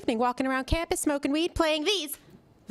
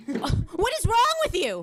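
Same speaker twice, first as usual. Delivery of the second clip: slow, louder exasperation; exasperated dad; 4 semitones higher, 4 dB louder than usual. Retold or imitated. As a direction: demand it, fed up. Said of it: "Conversation went something like this".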